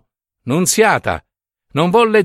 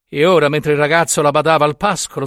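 plain reading voice

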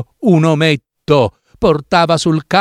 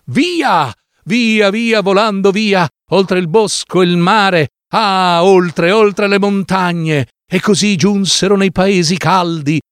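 soaring excitement; 6 semitones higher than usual. A narrator's reading. Flight away with the swallow.

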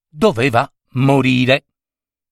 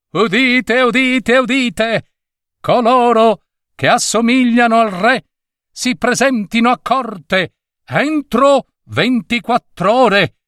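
town crier; 10 semitones higher than usual. Acted. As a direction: cry it out across the square like a crier. Herald reads royal proclamation.

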